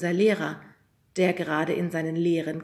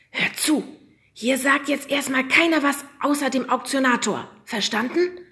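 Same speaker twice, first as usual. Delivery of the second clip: hissed warning; 7 semitones higher, 5 dB louder than usual. A narrator's reading. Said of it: "zischte der Lehrer".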